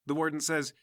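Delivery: ordinary talk, nothing marked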